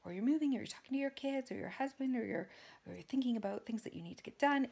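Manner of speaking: quick, high, rushed and anxious; fast stressed talk. Shown as a demonstration. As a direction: rattle it off like a stressed mom. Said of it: "when you talk fast".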